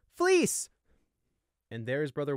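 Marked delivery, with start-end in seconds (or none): 0.1-0.7 high, loud calling out; joyful yell. Acted. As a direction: shout his brother's name across camp. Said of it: "Gossamer yelled out".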